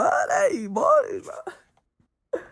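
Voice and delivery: a sudden high, loud squeal, slow and stretched. Shown as a demonstration. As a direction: squeal the word out like a cry of joy. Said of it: "I love talking like this".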